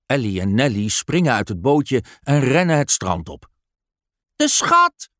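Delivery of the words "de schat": put-on very high, loud girls' cry of "schat"; children rushing for the treasure; excited cry. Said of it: "roepen ze uit". (zeer hoog, luid en opgewonden uitroepen)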